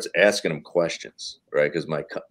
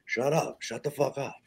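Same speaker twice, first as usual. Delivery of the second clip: dad hushing his laughing son in the audience; hushed scolding; 5 semitones higher, 8 dB quieter, faster than usual. Retold or imitated. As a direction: hiss it softly so nobody hears.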